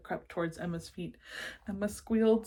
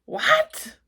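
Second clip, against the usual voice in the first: shocked; 11 dB louder than usual. Acted. Monster shocked.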